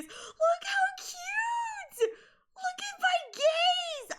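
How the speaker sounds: very high, long drawn-out squeal; delighted squeal. Real, not acted. Streamer showing chat Kumo's close-up, delighted squeal. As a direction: squeal each word out with adoration.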